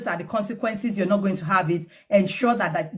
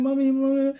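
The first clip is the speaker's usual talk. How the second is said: imitated crying child; 5 semitones higher than usual. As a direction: imitate a little one crying for mommy. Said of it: "and they're crying".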